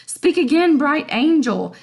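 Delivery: mock-thrilled Romeo gushing at Juliet; smitten excitement. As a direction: burst out like a starstruck fan. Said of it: "And he's like".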